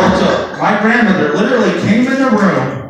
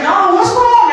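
high-pitched, drawn-out whine; imitated wife; 4 dB louder, slower than usual. Wife asking the comic what is wrong, high-pitched mimicry. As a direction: mimic her much higher and insistent.

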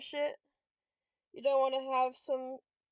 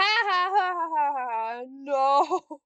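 loud wailing laugh; 2 semitones higher, 9 dB louder, slower than usual. Real, not acted. Player calling the game rigged, wailing laugh. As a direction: laugh out loud in disbelief, then wail.

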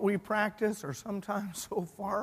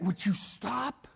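slow, lowered, weary rebuke; exasperated dismissal; 2 semitones lower, 7 dB quieter, slower than usual. Retold or imitated. Praying group to Rhoda, exasperated hush. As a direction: put the interrupter down quietly.

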